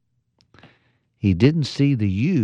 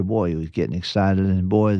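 usual speaking voice

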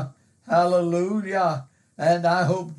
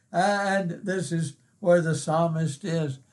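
ordinary delivery

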